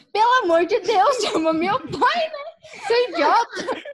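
loud, high, slowed crying plea, laughing through it; crying yell. Retold or imitated. Injured girl begging her cousin to fetch dad, loud crying yell. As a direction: yell for help half-laughing, retelling a crying child. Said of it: "Toda cho-, chorando assim".

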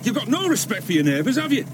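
fed-up anger. Acted. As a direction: have it out with the neighbours. Farmer quarrels with neighbours.

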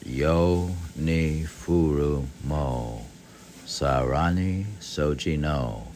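very slow, measured Japanese verse; slow recitation of a Japanese haiku, rising on the last line. Acted.

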